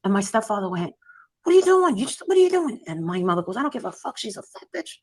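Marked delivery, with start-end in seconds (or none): 1.4-2.8 high, loud imitation of an appalled stepfather; retold alarm. Stepfather reacting to mother cursing the nurse, retold alarm. 3.6-4.9 high, softer imitation of an unrepentant mother; retold defiance. Mother answering the stepfather about the nurse, retold defiance.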